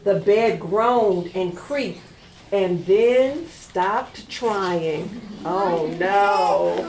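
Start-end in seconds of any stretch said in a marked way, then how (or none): none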